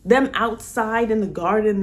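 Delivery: usual speaking voice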